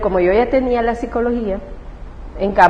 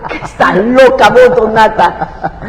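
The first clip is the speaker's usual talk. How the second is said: loud, laughing "are you crazy"; laughing disbelief; 7 dB louder than usual. Retold or imitated. Mother laughing at her daughter's claim, loud and amused.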